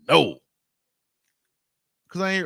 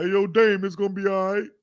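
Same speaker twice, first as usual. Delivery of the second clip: higher-pitched street call to a passing car; bum yelling; 8 semitones higher than usual. Retold or imitated. Roadside man calling out to Dame Dash, yelling. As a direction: holler it across the road. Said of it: "on the side of the road yelling".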